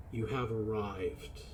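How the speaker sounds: low, slow machine god; deep mechanical voice. Acted. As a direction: intone it slowly and deep. Giant mechanical face speaks.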